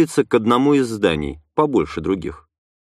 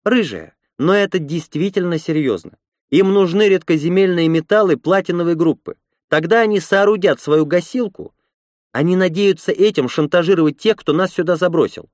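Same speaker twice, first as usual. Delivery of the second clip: higher-pitched woman's character voice; Lena, earnest; 8 semitones higher than usual. A narrator's reading. Lena warns the red-haired woman of the danger, earnest high voice. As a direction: argue earnestly in a raised pitch.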